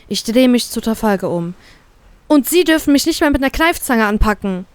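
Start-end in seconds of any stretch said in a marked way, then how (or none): 2.3-4.7 high-pitched, indignant rebuke; vociferous objection. A narrator's reading. Captive turning on the captain, indignant. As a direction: tell them off in a raised voice. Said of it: "merke ich lautstark an".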